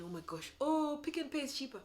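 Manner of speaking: hammed-up complaint; mock snooty shopper. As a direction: play a whining shopper. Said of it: "Just like you don't go".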